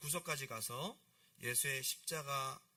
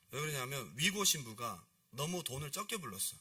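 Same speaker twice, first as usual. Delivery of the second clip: ordinary delivery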